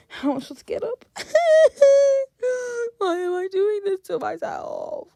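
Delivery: high-pitched comic wail; mock crying. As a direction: whine and fake-sob in despair. Despairing at a hard game.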